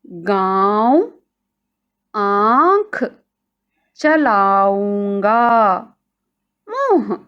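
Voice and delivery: each word said apart and clearly, nasal vowels held; careful model words. Shown as a demonstration. Teacher to children, word list read out with nasal vowels. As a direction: read गांव, आंख, चलाऊंगा, मुंह one at a time with the chandrabindu heard. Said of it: "इसके शब्द हैं".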